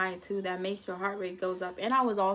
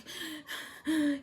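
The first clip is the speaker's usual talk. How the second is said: sharp retold gasp for air; 7 semitones higher than usual. Panicking student gasps at the teacher. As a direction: gasp like you can't breathe. Gasping for water in class.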